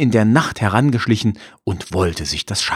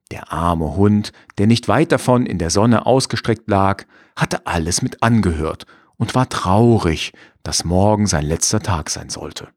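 ordinary delivery, nothing marked

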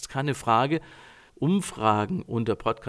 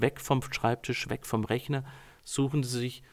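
normal talking voice

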